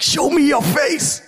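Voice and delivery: high, urgent cry to God; hungry plea. Retold or imitated. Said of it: "Moses was hungry. He's hungry after God".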